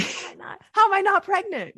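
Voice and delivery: high-pitched, incredulous question; retold disbelief. Telling cohost about her unexpected period, retold disbelief. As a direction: protest in baffled disbelief, pitch jumping up. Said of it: "I'm like".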